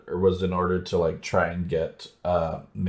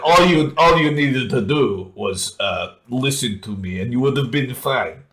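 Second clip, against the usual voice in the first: hammy Russian villain; 5 semitones higher than usual. Retold or imitated. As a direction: overplay the villain's scolding. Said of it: "the villain voices".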